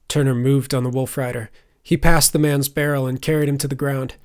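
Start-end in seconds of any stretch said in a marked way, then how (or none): none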